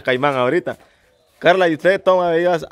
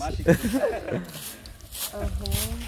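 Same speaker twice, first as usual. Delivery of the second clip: high, soft giggling laugh; real laugh; 5 semitones higher, 10 dB quieter than usual. Real, not acted. Host after a cheeky question, high soft laugh. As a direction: trail into quiet, high laughter.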